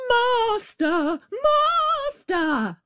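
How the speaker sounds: very high piercing cry; harp crying out. A narrator's reading. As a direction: shriek for the master. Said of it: "the harp called out quite loud".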